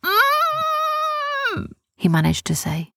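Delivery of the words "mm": high, long muffled "mm"; muffled scream. A narrator's reading. Boy grabbed in the night, muffled scream. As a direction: scream through a hand clamped over the mouth. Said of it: "tried to scream, but there was a hand over his mouth".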